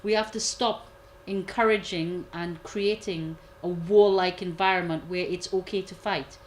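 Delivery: ordinary talk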